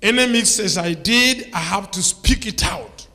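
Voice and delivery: loud, driving sermon climax; preacher declaring he will expose his past, loud and driving; full-force preaching. Real, not acted. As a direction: shout it out against Satan.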